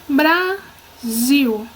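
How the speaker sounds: Brasil broken into Bra, pause, zil; syllable by syllable. Shown as a demonstration. Teacher dividing a word for the class, Brasil syllable by syllable. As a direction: say Brasil slowly in two separate syllables. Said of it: "Separando a palavra".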